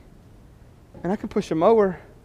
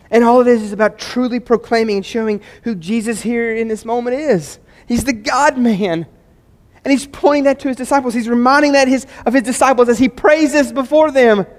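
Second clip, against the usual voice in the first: higher, louder preaching push; building preaching; 7 semitones higher, 8 dB louder than usual. Acted. Pastor explaining Jesus to the congregation, rising preaching. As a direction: lift the room toward the climax.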